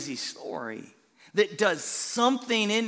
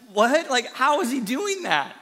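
a louder startled what, then baffled; mock amazement; 5 semitones higher, 5 dB louder than usual. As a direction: act stunned by the miracle. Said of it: "And he's like".

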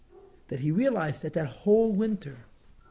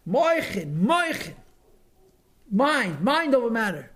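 "Moichin" and "Mind" pitched high, then falling; high, loud sing-song landing on "moichin" and "mind"; emphatic Rebbe's intonation; 7 semitones higher, 5 dB louder, slower than usual. Retold or imitated. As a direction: ring out "Moichin" and "Mind" with a high, forceful swoop. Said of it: "the music of these words".

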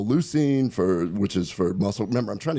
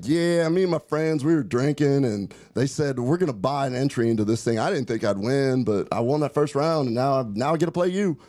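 higher-pitched mimicked Little Rock, Arkansas good old boy; imitated Arkansas accent; 4 semitones higher than usual. Retelling the opponent's drinking confession, imitated Arkansas accent. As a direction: do an easygoing Arkansas day drinker. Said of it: "the way that he was trying to mimic the accent".

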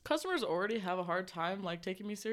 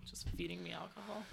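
hushed whisper aside; 10 dB quieter than usual. Real, not acted.

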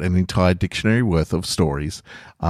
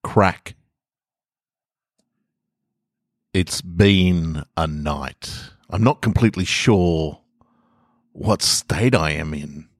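story narration. A narrator's reading. Reads own short stories aloud.

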